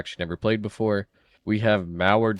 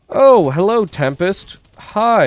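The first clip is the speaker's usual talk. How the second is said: mock greeting; 10 semitones higher, 9 dB louder, much slower than usual. Greeting a monster mockingly.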